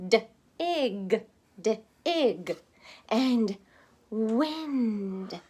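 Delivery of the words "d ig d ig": "d-ig" split into d then ig; sounding out. Shown as a demonstration. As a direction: sound out "d-ig" slowly. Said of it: "sounded out words".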